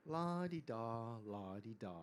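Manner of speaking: slow, softening singsong stroll; innocent, carefree lilt. A narrator's reading. Girl and tiger hike along, carefree singsong. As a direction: lilt it lazily, not a care. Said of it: "innocently hiked along".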